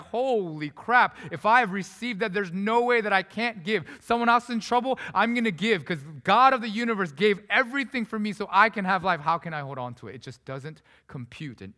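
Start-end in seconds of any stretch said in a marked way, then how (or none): 0.0-10.1 loud, high, fervent outburst of gratitude; excited exclamation. Retold or imitated. New believers amazed by grace, exclaiming loudly. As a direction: cry it out as if the gift just hit you. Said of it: "And they're like".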